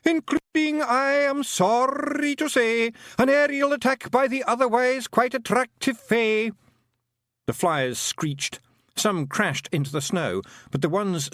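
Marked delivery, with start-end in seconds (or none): 0.0-6.5 high, loud hammed-up poet declaiming; theatrical groaning recital. A narrator's reading. Pixie poet reciting bad verse at the fairies, hammed-up groan. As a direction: groan the verse like tragic poetry.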